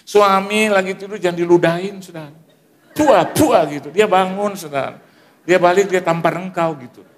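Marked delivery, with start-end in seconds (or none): 2.9-3.6 mock spitting sound. Imitating spitting on husband.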